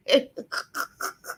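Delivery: soft wheezing, voiceless laugh; real laugh. Real, not acted. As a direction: laugh until only breath is left. Cracking up after shout-out.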